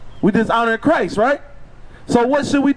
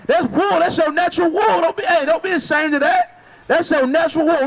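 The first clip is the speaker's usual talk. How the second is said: raised pitch, insistent street preaching; fervent reassurance in a higher voice; urgent exhortation; 5 semitones higher than usual. Real, not acted. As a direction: urge him on emphatically, pitched up. Preacher urgently urges listener over hair.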